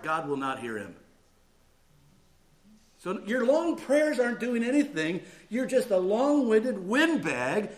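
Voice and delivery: loud, high, hammering denunciation; full-force preaching. Acted. Pastor denouncing long-winded windbags, full-force preaching. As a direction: thunder it from the pulpit.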